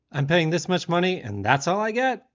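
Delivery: mock yell, climbing high; retold scream. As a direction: imitate an outraged complaint, not a full scream. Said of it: "he screams in a British accent".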